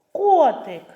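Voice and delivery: «Котик» called high and loud, stressed о held; calling out. Shown as a demonstration. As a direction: call KOOO-tyk, stretching о in «Котик». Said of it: "Тут наголошений «о»". (гучно кличе, протяжне «о» в слові «Котик»)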